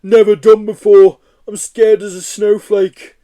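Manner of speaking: loud, slow, high frightened beast; scared Yeti. Acted. Yeti scared on the skateboard, loud slow frightened voice. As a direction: quaver it like a terrified giant.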